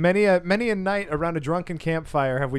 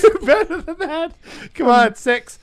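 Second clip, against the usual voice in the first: high, louder, laughing encouragement; laughing cheer; 11 semitones higher, 7 dB louder than usual. Real, not acted. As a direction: egg him on through laughter.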